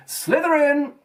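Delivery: high, sharp screamed announcement; hat screaming. A narrator's reading. The hat screaming the house at once, high shout. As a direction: shriek the house name instantly. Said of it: "it screamed".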